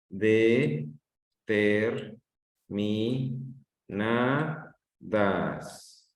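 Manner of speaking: "De-ter-mi-na-das" muy lento, con pausa tras cada sílaba; sílaba por sílaba. Shown as a demonstration. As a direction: separa cada sílaba de "Determinadas". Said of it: "escucha, aprende a escuchar". (sílaba por sílaba, muy despacio)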